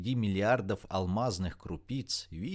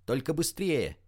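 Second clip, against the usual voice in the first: higher, hurried-sounding call; shouted urging; 5 semitones higher than usual. A narrator's reading. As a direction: urge her on from below. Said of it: "выкрикнула Рита".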